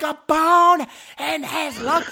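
high, stretched-out incredulous cry; yelling out. Retold or imitated. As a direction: holler it slowly in disbelief. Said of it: "I yell out".

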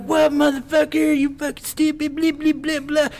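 high, squeaky ranting woman voice; mock angry woman. Drunk woman cursing him from the floor, high mock rant. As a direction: mimic her furious rant. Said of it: "she's like".

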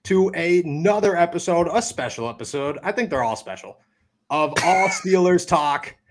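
show host intro. Acted.

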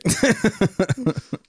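high giggling laugh. Real, not acted. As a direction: laugh helplessly at the joke. Laughing at the joke threat.

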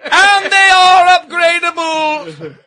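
high, loud, slow proclamation; hammed-up castle crier selling wares; mock medieval herald. Mock medieval castle sponsor pitch.

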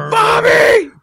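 very high, full-volume mock holler; imitated film yell. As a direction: shout the name out high and long.

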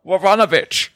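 high, hammed-up Eastern European accent; bad Eastern European accent. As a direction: ham up a cartoon Eastern European voice, slow and high. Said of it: "You can't even do the accent anymore".

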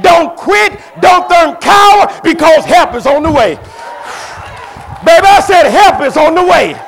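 high, loud fiery sermon shouting; preaching at full force. Acted. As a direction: rouse the church, never let up. Preaching climax to congregation.

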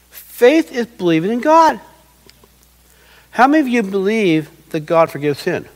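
normal talking voice

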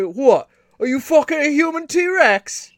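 bad British accent. Mocking a T-Rex-like bloke for cohost, bad British accent. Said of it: "the worst accent possible".

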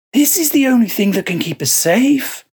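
a monk sure of his sin; Joseph voice. Acted.

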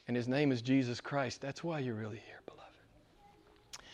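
very soft, sinking into a whisper; hushed reverence. Real, not acted. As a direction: drop to an intimate hush for the congregation. Hushed turn after fervent preaching.

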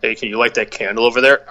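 ordinary talk, nothing marked

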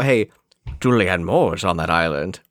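a light hint of Sean Connery; lightly voiced Hammond, a touch of a Scottish brogue; Sean Connery impression. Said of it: "turned Richard Hammond into Sean Connery with your impression".

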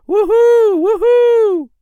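very high, loud whooping; crowd cheering. A narrator's reading. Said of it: "The crowd cheered".

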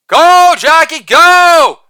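high, loud cheer; cheering shout. Acted. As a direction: cheer the runner on at full voice. Cheering on a ballplayer.

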